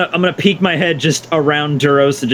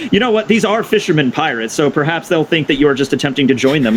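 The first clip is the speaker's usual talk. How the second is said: normal talking voice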